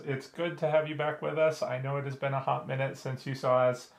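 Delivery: ordinary delivery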